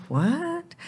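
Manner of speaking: a high, rising mock-puzzled "What?". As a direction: imitate a baffled listener asking "What?". People puzzled by his job.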